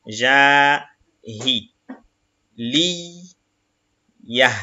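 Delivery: each Arabic syllable said apart, slowly; syllable reading. Shown as a demonstration. Teacher reading Arabic syllables to the class, slow and separated. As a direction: spell the word out syllable by syllable. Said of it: "donc qui prolonge".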